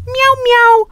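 very high squeaky meow, meow; mock cat meow. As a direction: play a tiny kitten inside a giant puma. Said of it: "puma just said".

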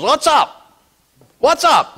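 high-pitched, gruff imitated accent; Smith Wigglesworth's accent. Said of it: "in his accent, he goes".

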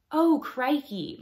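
put-on drawn-out "crikey" in a plain American accent. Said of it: "like a normal, like, American, accent".

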